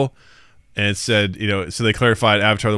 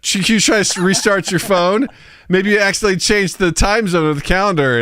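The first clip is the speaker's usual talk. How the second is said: higher-pitched mock-helpful chatbot; condescending helpfulness; 8 semitones higher than usual. Chatbot suggests phone fixes to a doubting user, condescendingly helpful. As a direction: lightly voice a patronizing bot, pitched up.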